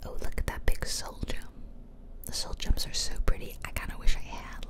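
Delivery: ordinary delivery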